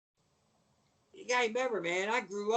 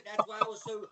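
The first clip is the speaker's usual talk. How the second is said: usual speaking voice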